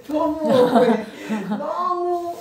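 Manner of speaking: a pained laugh through the words. Real, not acted. Laughs while recalling injustice. (웃으면서 억울함 토로)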